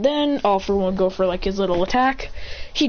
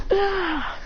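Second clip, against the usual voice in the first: high gasp; 10 semitones higher, 3 dB quieter than usual. Retold or imitated. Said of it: "He goes".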